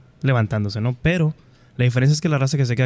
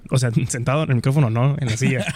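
usual speaking voice

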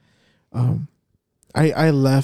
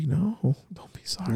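soft, unvoiced reassurance; gentle whisper; 3 semitones higher, 7 dB quieter than usual. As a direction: whisper to comfort her. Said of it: "I'm just like".

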